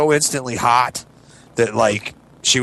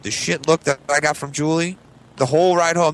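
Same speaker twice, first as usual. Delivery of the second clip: normal talking voice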